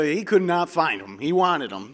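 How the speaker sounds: ordinary talk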